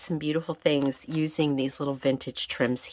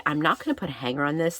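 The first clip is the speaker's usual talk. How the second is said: usual speaking voice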